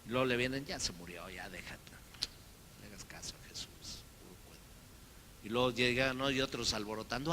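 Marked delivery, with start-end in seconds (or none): 0.6-4.6 lower, softer, half-whispered dismissal; discouraging doubters. Retold or imitated. Doubters tell Jairo to forget Jesus, in a low murmur. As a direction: murmur doubt to the grieving father.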